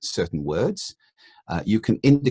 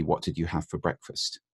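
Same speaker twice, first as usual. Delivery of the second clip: "What did you have for breakfast?" asked open and softer, no options; open clean question; 2 semitones lower, 8 dB quieter than usual. Shown as a demonstration. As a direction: ask it neutrally, no steering. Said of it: "the clean question would be".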